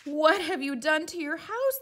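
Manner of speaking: high, loud outraged cry; shouting neighbours. Acted. Neighbours shouting at the man, high and loud. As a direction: yell it across the street. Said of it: "they shouted at the man".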